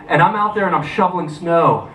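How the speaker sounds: ordinary delivery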